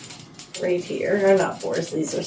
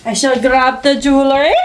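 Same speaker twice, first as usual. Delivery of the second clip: high, loud British lady announcement; mock British dowager, pitched up; eccentric old British lady; 6 semitones higher, 5 dB louder than usual. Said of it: "some eccentric old British lady".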